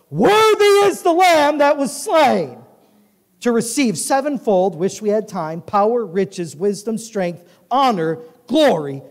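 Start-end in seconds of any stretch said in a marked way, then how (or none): 0.1-2.6 high, loud, slow proclamation. Acted. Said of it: "saying with a loud voice". 3.4-4.8 high, loud preaching cadence; full-force scripture reading. Acted. Reads the whole passage at full force.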